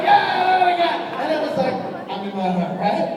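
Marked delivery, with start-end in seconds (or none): 0.0-1.2 excited yeah. Retold or imitated. Excited cheering on stage.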